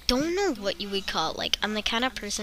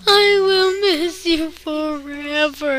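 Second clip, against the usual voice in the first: loud, high, slow wailing farewell; mock wail; 9 semitones higher, 10 dB louder, slower than usual. Friend leaving says goodbye in a loud high wail. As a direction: ham up a tearful goodbye.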